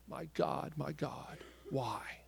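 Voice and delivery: the cry turned into a hushed whisper. Retold or imitated. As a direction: breathe it out, almost silent. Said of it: "he screamed".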